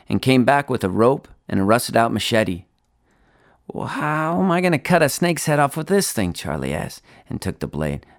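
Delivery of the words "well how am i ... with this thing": put-on higher-pitched doubtful boy; worried boy. A narrator's reading. Charlie doubting the machete, in a higher boy's voice. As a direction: question it like an unconvinced kid.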